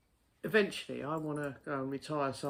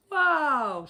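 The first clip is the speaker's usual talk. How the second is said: stunned wow; 11 semitones higher, 7 dB louder than usual. Retold or imitated. Stunned by customer's insult.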